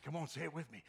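ordinary talk

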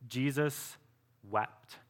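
slow, hushed, grave reading of "Jesus wept"; solemn reading. Shown as a demonstration. Preacher reading the shortest verse, hushed and grave. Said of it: "the shortest verse in the Bible".

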